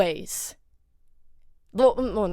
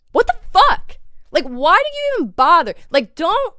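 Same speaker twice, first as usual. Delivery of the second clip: high, loud, fed-up demand; real indignant exasperation; exasperated outburst; 6 semitones higher, 4 dB louder than usual. Real, not acted. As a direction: burst out in disbelief, pitch jumping up. Fed-up outburst at misbehaving influencers.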